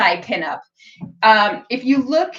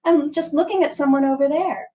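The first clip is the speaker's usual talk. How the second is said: coy innocent; 5 semitones higher than usual. Shown as a demonstration.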